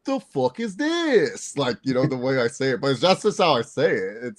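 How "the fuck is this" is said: much higher, slow, drawn-out "The fuck is this?"; friend's high mocking take on the catchphrase; Romeo's version. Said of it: "He goes".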